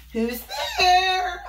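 high wolf pretending to be Granny; fake high granny. Wolf in Granny's bed calling to Little Red. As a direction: put on a granny voice.

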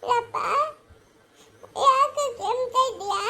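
wailing through tears; tearful. Acted. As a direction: cry the words out. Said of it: "ಯಾಕೋ ಗಂಧ ಅಳ್ತಾ ಇದ್ಯಾ".

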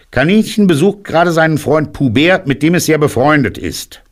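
very high put-on rabbit voice; disguised rabbit. A narrator's reading. Rabbit pretends rabbit is away visiting, high voice. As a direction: pitch it way up, prim and evasive.

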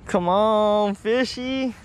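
usual speaking voice

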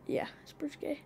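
whispered aside. Real, not acted. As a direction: breathe it as a secret aside.

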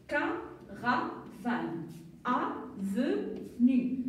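slow, syllables stretched apart; teacher stretching each syllable for the class; slow sounding out. Shown as a demonstration. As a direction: read it out slowly so children can follow. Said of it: "quand on va relire encore une fois".

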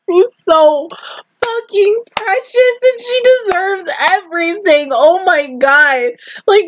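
ordinary delivery

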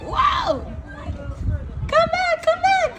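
coaxing mommy call from across the playground. Real, not acted. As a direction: call him back brightly, pitched well up. Mother calling child back.

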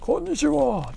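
high, soft actor impression; Hiraizumi Sei impression. Streamer recalling an old gameplay impression, high soft mimicry. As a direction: greet in a put-on high mimic voice. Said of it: "ちょっと真似してたんですよね".

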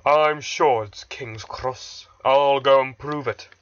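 loud, slow stubborn grumble; grumbling. A narrator's reading. Gordon rolling away from the argument, grumbling. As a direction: grumble on with heavy determination. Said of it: "still grumbling".

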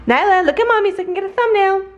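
high, fast eager call; calling the dog. Real, not acted. Owner calling Nyla to look at her for a photo. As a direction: grab the puppy's attention.

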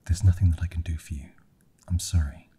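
loud, hurried, helpless apology; frustrated apology. A narrator's reading. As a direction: blurt it out in frustration. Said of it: "She started to weep out of sheer frustration".

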